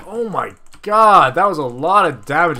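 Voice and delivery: loud, drawn-out disbelief; stunned, loud gamer reaction; shocked exclamation. Real, not acted. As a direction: cry out at a sudden heavy hit. Reacts to sudden heavy game damage.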